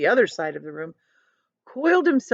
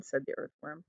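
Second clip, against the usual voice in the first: ordinary delivery, nothing marked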